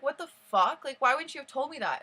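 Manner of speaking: loud, high retold outrage; outraged disbelief. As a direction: re-enact an indignant outburst. Retold outraged reaction to friend's news.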